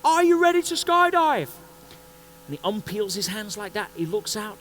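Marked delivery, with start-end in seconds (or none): 0.0-1.5 high, loud shout over the engines; instructor shouting. Retold or imitated. Instructor yells to the first-timer over the engines, loud. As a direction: bellow the question over the plane noise. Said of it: "He goes".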